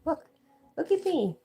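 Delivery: a sudden high, loud squeal for the dog; pretend dog voice. Dog shows off to the stream in a squealing, loud voice. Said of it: "He's like".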